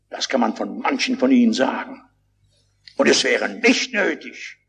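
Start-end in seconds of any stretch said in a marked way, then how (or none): none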